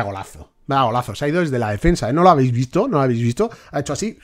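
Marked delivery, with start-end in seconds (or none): none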